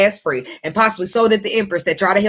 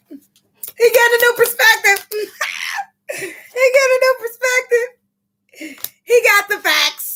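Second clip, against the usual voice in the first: high, slow, laughing-crying delight; excited; slower than usual. Real, not acted. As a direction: squeal it out in joyful disbelief. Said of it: "I'm getting excited".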